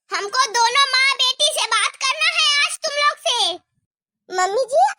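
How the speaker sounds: ordinary talk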